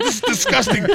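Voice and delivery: very high, squealed disgust; mock disgust. Reacting to smelling like cat pee, high disgust. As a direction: recoil from your own stink.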